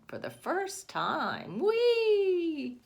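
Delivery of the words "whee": high, loud sledding whee; gleeful high whee; whee of delight. Pretend. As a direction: squeal it out as the sledder speeds off. Voicing child's first sled ride.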